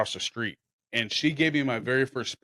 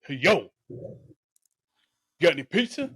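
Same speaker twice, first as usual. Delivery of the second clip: mock thug; 5 semitones higher than usual. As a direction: imitate a swaggering wannabe gangster. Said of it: "he walks in like he's a thug".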